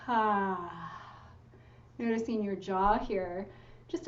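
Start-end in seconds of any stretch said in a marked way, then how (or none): 0.1-1.0 long falling "ah" sigh; audible sigh. Shown as a demonstration. Meditation guide shows listeners how to release, long audible sigh. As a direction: sigh it out slowly on an open vowel. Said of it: "let out a sigh or a sound".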